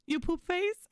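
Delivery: high, bright sing-song question to the dog; grandma's playful dog voice. Retold or imitated. Grandma asking Bucky the walk question, playful and high. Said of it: "She'll look at him and she'll go".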